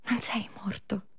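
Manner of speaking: soft whispered insistence; hushed reassurance; whispered coaxing. Acted. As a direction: murmur it close and gentle. Coaxing Lazzaro out.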